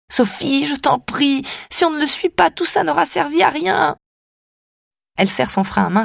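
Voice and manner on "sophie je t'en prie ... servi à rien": high, pleading voice. Acted. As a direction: beg her, voice rising high. Narrator begs Sophie to go.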